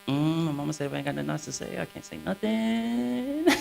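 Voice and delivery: very soft, sulky little mumble; mock quiet. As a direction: barely say it, stretching out the last words. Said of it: "I'll be so quiet".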